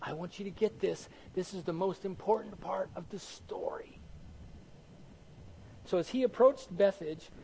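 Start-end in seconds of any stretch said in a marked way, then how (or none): 0.0-4.0 hushed, fading into a near-whisper; God speaking intently. Acted. Preacher voices God telling us what matters most, hushed and intent. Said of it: "God says to us by example".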